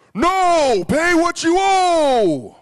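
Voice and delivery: loud, high, drawn-out royal bellow; booming king. Acted. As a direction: roar a slow refusal like a pompous king. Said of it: "the king needs a booming voice".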